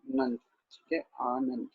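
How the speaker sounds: आनंद with a long, high opening aa; careful pronunciation. Shown as a demonstration. Teacher showing students how आनंद is read, long first vowel. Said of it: "इसको उचारा जाएगा".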